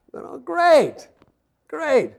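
high, mock-cheerful sarcasm; a wry groan of fake delight; sarcastic dismay. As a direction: say it like the last thing you wanted. Car leaking, mock cheer.

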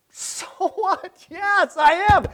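high, cocky shrug of a reply; defiant so what. Retold or imitated. Healed man shrugging off the Pharisees, high and cocky. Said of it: "this guy would probably say".

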